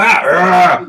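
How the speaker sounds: mock tough-guy roar; gruff roar. Showing viewers how the boxer should talk, mock roar. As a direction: roar as a grumpy boxer ought to sound. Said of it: "He doesn't talk the way he looks".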